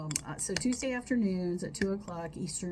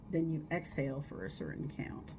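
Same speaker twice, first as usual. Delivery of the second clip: normal talking voice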